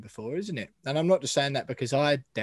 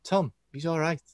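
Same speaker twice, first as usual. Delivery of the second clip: bad Scouse accent; 3 semitones higher than usual. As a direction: fumble an impression of a Scouse footballer. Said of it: "I can't do Scouse accents".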